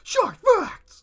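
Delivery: high, loud excited call; excited shout. Real, not acted. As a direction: announce it like a game show host. Excited catchphrase callback.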